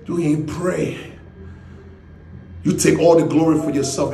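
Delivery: ordinary delivery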